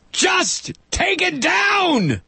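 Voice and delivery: high slow hammed-up shout; mock shout. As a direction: bellow the order mockingly. Mocking the order.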